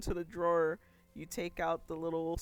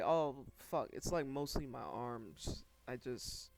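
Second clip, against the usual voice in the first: weary client; 6 semitones lower, 7 dB quieter than usual. Acted. GM voices weary client Nala.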